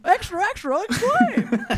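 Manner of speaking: high newsboy cry with a laugh; newsboy yelling headlines. Shown as a demonstration. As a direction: hawk it like a paperboy. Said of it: "in a Newsies voice".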